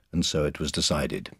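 usual speaking voice

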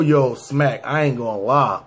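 ordinary talk, nothing marked

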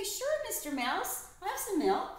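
high, chirpy yes to a mouse; high sing-song reply to a mouse; bright sing-song. Acted. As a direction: answer like a kind hostess to a tiny guest.

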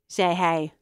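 ordinary delivery, nothing marked

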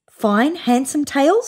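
high, slow hopeful question. A narrator's reading. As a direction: ask it full of hope. Same hopeful question again.

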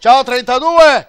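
slow, booming hello; loud greeting. Real, not acted. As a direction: call out a big greeting. Host greets a sponsor loudly.

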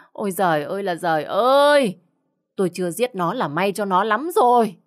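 wail, then let the anger climb; outraged wailing. Acted. Mother justifying the beating to husband, outraged wail.

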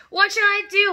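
high, loud helpless wail. Acted. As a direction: wail it in a panic. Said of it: "wailed the father".

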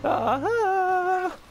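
high gleeful victory cry; triumphant ah-ha. Real, not acted. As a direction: crow it out in triumph. Triumphant in-game exclamation.

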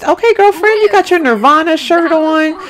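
high-pitched playful sass; sassy hype. Pretend. Player greets a showy lady at the pet store, sassy hype. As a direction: hype her up in a high, teasing voice. Teasing a flamboyantly dressed game character.

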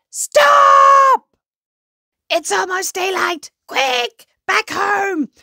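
high, loud urgent shouting; alarmed yell. A narrator's reading. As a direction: yell out a panicked warning to the crowd. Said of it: "someone yells out".

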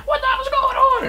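very high shrill mock yell; kid's mom coming outside, mock outraged high voice; angry mom. Mom storming outside, shrieking high. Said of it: "she was like".

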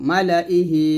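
মালা ইহি read quickly with no stretch on the লা; মালা ইহি clipped short, no madd; quick recitation. Shown as a demonstration. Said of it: "এরকম তাড়াতাড়ি করে পড়বো".

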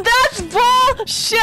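slow furious yell; raging gamer. Real, not acted. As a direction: shout in disbelief. Angry rage at game kill.

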